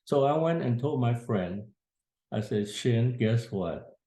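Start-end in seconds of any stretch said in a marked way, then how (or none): none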